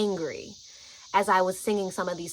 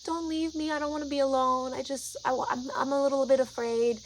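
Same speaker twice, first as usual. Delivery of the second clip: put-on scared young child; 5 semitones higher than usual. Imitating a frightened friend begging for company, small childlike plea. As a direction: imitate a timid little kid pleading. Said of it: "five or six-year-old type of energy".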